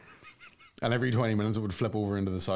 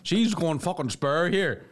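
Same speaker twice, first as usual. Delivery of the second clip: mock irate Scottish caller; 8 semitones higher, 4 dB louder than usual.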